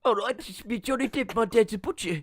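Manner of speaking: hammed-up aside to the camera; mock South African aside; Depp breaking character. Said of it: "he'll look at the screen and go".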